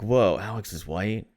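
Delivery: ordinary delivery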